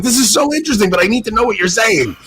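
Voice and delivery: amused frustration. Retold or imitated. Telling the priest he is lost.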